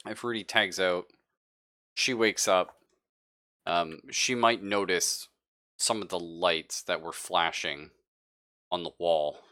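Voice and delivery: ordinary delivery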